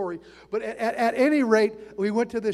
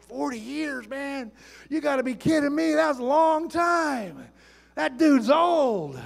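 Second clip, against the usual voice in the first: mock young student's drawn-out disbelief, pitched up; incredulous; 5 semitones higher, slower than usual. Student marveling at a teacher's forty years, incredulous. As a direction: stretch it out in mock amazement at the age. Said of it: "I remember thinking when I first sat".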